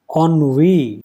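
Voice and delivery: ennui as "on-WEE", set apart slowly; correct pronunciation. Shown as a demonstration. Teacher asking students to take it like this, ennui as "on-WEE". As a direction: model "ennui" clearly as on-wee. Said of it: "ऐसा समझिए".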